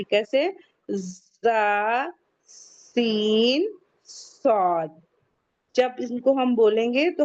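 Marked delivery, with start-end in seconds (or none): 1.4-4.9 سین with a long drawn whistling hiss; whistling sibilants. Shown as a demonstration. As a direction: hold the س hiss slowly, whistle through the teeth. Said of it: "تین حروف میں سیٹی کی آواز آتی ہے". (سیٹی کی آواز کے ساتھ لمبا کھینچ کر)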